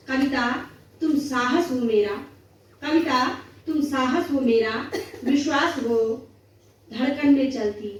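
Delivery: declaimed in short phrases with pauses; poem recitation. Acted. Poet addressing poetry itself as love and courage, declamatory recitation.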